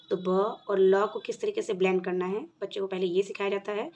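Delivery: ordinary talk, nothing marked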